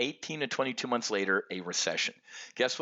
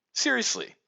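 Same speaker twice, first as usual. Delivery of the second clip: incredulous; 12 semitones higher than usual. Real, not acted. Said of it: "incredulous aside".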